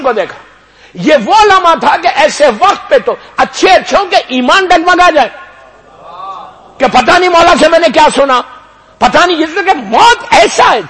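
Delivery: high, loud majlis oration; preaching at full force. Acted. Preacher telling the mourners of the execution, full-force high oration. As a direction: drive the scene home to the crowd.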